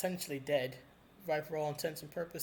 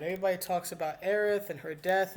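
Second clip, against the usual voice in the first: usual speaking voice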